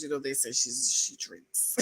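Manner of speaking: a sly hushed aside; conspiratorial whisper; whispered gossip. Real, not acted. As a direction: whisper it like a secret. Gossipy aside about a cast member.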